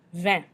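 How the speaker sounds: Vin with a nasal "eh" ending; French Vin, open nasal vowel, no hard N; French eh sound. Shown as a demonstration. Teacher models French for wine, nasal eh in Vin. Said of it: "with the eh sound".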